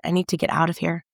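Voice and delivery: ordinary delivery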